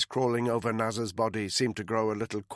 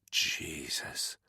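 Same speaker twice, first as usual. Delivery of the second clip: lower, soft breathed oath; 7 semitones lower, 6 dB quieter than usual. Acted. Soldier stunned by the news, softly breathing the oath. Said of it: "Danny breathed".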